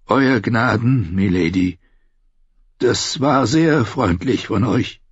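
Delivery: put-on slow old man's voice; courteous old maester. Old maester thanks king and lady, slow and courteous. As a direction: give thanks slowly and formally.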